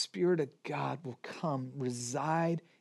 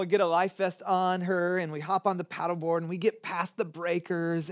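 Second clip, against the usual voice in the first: ordinary talk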